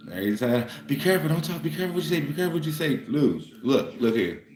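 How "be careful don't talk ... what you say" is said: higher, imitating a worried relative's warning; nagging relatives. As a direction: mimic the family hushing you. Family warning him at gatherings.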